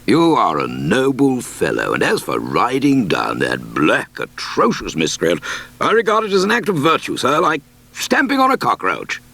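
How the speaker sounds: hearty, booming country squire praising a messenger; bluff squire. Acted. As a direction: speak as a proud, lordly squire, rising high and quick at the end. Squire's voice in story.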